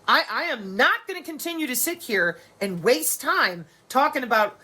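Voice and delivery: ordinary delivery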